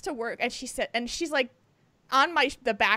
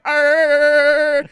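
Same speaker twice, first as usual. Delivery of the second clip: chattering teeth noise; 12 dB louder than usual. Retold or imitated. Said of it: "my teeth are going".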